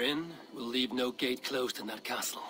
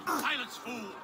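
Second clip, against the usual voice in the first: angry rebuke; 8 semitones higher than usual. Acted. Silencing a prisoner.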